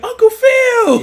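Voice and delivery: long, high, loud shout; excited calling out. Retold or imitated. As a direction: holler it in delighted greeting, drawn out. Acting Will greeting supposed uncle.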